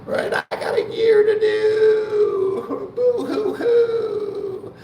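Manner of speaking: very high, slow fake-crying whine, wailing the boo hoo; mocking whiny crybaby. Mocking a new inmate crying about a year, high fake sob. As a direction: ham up a self-pitying new inmate blubbering. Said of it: "They're like".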